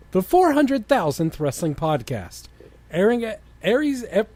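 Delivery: normal talking voice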